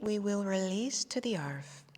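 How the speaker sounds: usual speaking voice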